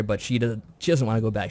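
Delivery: ordinary delivery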